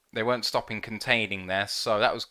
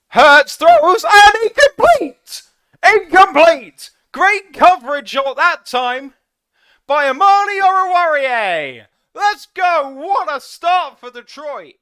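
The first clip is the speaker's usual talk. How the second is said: screaming celebration; 13 dB louder than usual. Real, not acted. Fan screaming as the Lions stop fourth down. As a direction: lose it completely at the stop.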